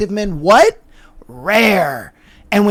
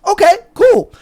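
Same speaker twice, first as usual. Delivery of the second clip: triumphant gotcha; 10 semitones higher, 5 dB louder than usual. Acted. Gotcha reaction.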